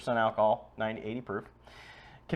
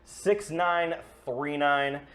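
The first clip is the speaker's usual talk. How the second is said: ad read; 4 semitones higher than usual. Real, not acted. Sponsor ad read.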